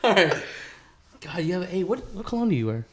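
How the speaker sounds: ordinary talk, nothing marked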